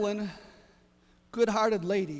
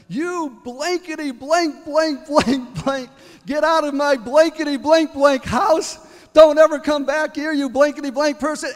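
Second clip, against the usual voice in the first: angry cussing; 6 semitones higher, 11 dB louder than usual. Retold or imitated. Evelyn cussing out the praying neighbor lady, furious retold yell. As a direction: snap and cuss her out, building to a roar. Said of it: "And Evelyn snapped".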